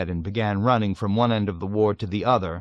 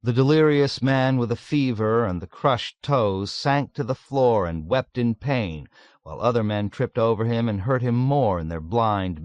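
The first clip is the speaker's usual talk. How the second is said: usual speaking voice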